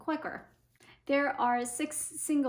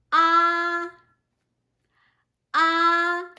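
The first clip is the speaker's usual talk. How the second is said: "ah" held high, level and loud; high first tone; 5 semitones higher, 11 dB louder than usual. Shown as a demonstration. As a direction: say a long, loud "ah" on one high note. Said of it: "first tone is a high tone".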